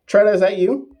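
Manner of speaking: high, loud surprised call; suddenly spot a friend in chat; surprised greeting. Real, not acted. Streamer spots a friend in chat, surprised call.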